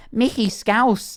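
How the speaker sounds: high, drawn-out Scouse imitation; Scouse accent. Imitating TikToks for a friend, Scouse accent. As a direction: stretch it out in Scouse. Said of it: "Scouse TikToks where it's like".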